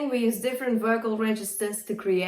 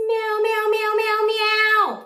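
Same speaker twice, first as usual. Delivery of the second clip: much higher and louder, slow forward meows; mixed voice meow; 13 semitones higher, 7 dB louder, slower than usual. Shown as a demonstration. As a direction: give the meows chest power and brightness. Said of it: "Mixed voice".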